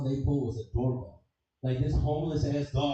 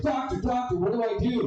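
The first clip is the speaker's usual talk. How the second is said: high, frantic plea to the doctor; mock panic, pitched way up; pleading patient; 9 semitones higher, 4 dB louder than usual. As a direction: squeal it like a desperate patient.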